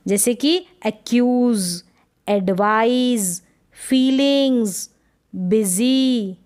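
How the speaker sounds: slow drawn-out z in एक्यूज, एडवाइज, फीलिंग्स, बिजी; z sounds drawn out. Shown as a demonstration. Teacher to class, slow clear z pronunciation. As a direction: stretch each word so the z buzzes. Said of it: "ऐसे ना ज पढ़ रहे हैं".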